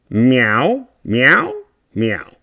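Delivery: loud playful meows; hammed-up loud cat meowing. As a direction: meow like a cat into the mic. Cat noises into mic.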